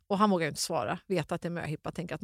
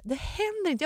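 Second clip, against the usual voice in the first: disbelief rising; 11 semitones higher than usual. Retold or imitated. Disbelief behind a tree.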